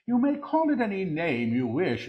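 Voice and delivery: lifted proclamation. Real, not acted. Same words repeated in full voice.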